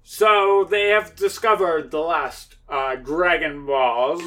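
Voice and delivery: high, loud broadcast voice; commentator. Acted. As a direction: announce the discovery to viewers.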